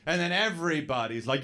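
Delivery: ordinary talk, nothing marked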